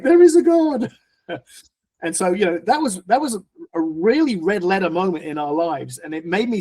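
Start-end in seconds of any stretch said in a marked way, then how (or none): 0.0-0.9 much higher and louder thankful exclamation; wife's joyful relief. Retold or imitated. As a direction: burst out like a relieved wife. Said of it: "my wife was like".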